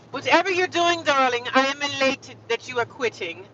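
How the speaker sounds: mock-grand, gleeful reply; hammed-up elation. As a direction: play up the grand delight at someone quitting. Hams up her reply to manager.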